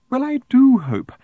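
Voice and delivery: high, loud swoop on DO; prim gushing. A narrator's reading. Woman starting her good wishes, primly gushing. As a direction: gush primly until cut off.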